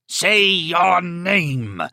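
loud, high, long-drawn roar. Acted. Killer demands captive give her name, roaring. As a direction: roar each word slowly. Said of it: "he roared at her".

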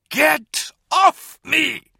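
high, loud, slow shriek. Acted. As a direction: scream to be let go. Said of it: "she shrieked".

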